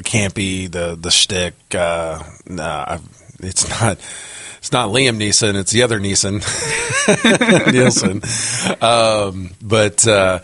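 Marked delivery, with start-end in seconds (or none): 6.5-7.7 sudden high laugh. Real, not acted. Laughing at mixing up Neeson and Nielsen. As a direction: burst of high-pitched laughter. Laughs at own name mix-up.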